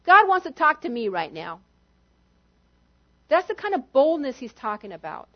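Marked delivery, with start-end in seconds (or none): 0.0-1.6 barge in brashly, shouting past the Pope; bold cutting in. Retold or imitated. Cutting in line to reach God, a brash shout. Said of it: "and you say".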